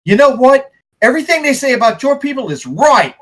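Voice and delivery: high, loud accusation; bitter Coulson. Acted. Betrayed man pointing at a former friend, bitterly angry. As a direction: lash out, pitched high. NPC insults player's people.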